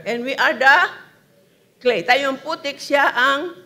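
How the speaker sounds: loud preaching, each phrase pushed out hard; full-force preaching. Acted. Preacher challenges the congregation, loud and forceful. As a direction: drive the question home to the crowd.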